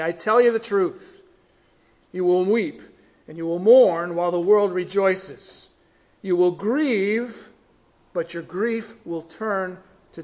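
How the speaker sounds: measured, solemn reading of the verses; solemn sermon reading; scripture reading. Acted. Reads scripture aloud in sermon.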